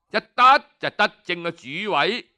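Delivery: usual speaking voice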